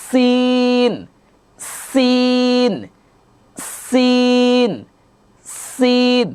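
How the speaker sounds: সিন with a long breathy s and high pitch; airy sin. Shown as a demonstration. Teacher showing the air on সিন for students. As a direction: let the air hiss out on সিন. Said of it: "আওয়াজটা করেন".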